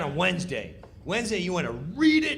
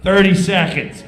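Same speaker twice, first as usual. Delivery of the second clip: countdown call; 12 dB louder than usual. Retold or imitated.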